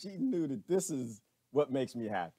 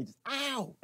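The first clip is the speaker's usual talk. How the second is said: yelp of pain; 13 semitones higher than usual. Pretend. Mimicking hand on hot oven.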